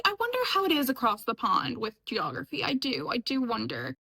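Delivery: normal talking voice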